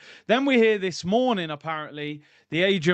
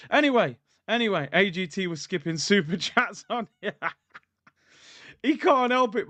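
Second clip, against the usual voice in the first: ordinary delivery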